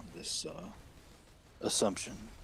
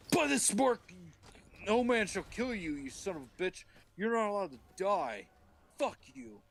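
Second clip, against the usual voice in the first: wrathful God; 12 semitones higher than usual. Acted. God cursing Cain with the mark, mock wrath. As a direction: proclaim it in hammed-up anger. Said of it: "and God's like".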